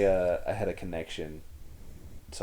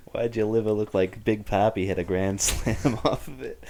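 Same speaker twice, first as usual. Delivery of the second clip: louder Boston surgeon, breaking into laughter; mock Boston accent; 3 semitones higher, 6 dB louder than usual. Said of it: "if your heart surgeon was like".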